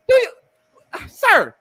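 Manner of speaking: ordinary talk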